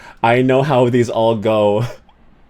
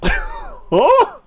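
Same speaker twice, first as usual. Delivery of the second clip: baffled huh. Pretend. Mock baffled reaction.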